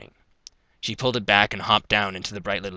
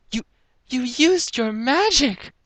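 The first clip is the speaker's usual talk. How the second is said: high, astonished character voice; amazed stammer; slower than usual. A narrator's reading. Rarity amazed at Sweetie's magic.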